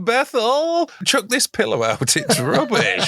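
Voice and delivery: high, squeaky Jacob complaining. Retold or imitated. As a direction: toss the pillow away in a high whine. Voicing Jacob about the pillow.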